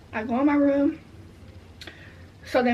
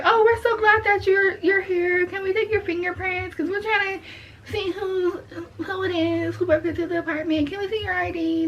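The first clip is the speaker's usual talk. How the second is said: higher, over-sweet police voice; mocking the police; 5 semitones higher than usual. Mocking the police who came asking for fingerprints, high and sugary. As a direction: sound fake-polite, like cops wanting something.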